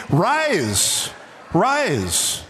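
drawn-out, ringing command; emphatic command. Acted. Preacher voicing Jesus ordering the paralyzed man, ringing. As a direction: stretch out each rise like an order.

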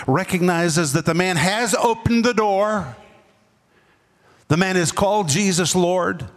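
ordinary talk, nothing marked

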